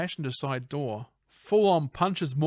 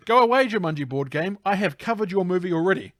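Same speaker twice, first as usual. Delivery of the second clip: louder, higher mock dismissal; mock banishing; 5 semitones higher, 5 dB louder than usual. Host to an intruding board game, mock banishing. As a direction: shoo it away in a raised, playful voice.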